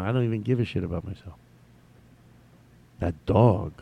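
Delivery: ordinary delivery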